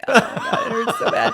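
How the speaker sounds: high, loud wail breaking into laughter; hammed-up whine of pain through laughter; mock pained wail. Injured passenger retelling her complaint to the attendant, mock wail with laughter.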